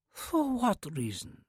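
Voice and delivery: put-on whispered, slow Russian-accented old lady; soft Russian accent. A narrator's reading. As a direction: breathe it like a breeze, Russian accent. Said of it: "the Russian accent undeniable".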